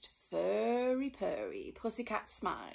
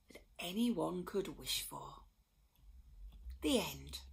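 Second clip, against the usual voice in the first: soft whispered ending; bedtime whisper; 2 semitones lower, 4 dB quieter than usual. A narrator's reading. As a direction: whisper the ending to a sleepy child.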